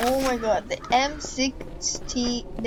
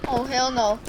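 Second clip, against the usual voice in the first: usual speaking voice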